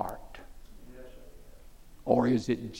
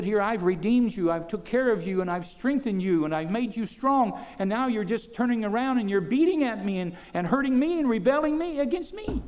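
lightly voiced God, higher and hurt; God's voice; 9 semitones higher than usual. God rebuking those he saved, a touch of hurt. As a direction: plead as God to ungrateful people. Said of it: "He said".

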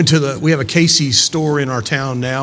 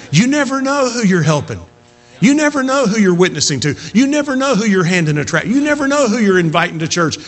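higher, driving pulpit voice; impassioned preaching; 7 semitones higher, 3 dB louder than usual. Acted. Preacher urging church to keep witnessing, impassioned. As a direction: press it home with rising passion.

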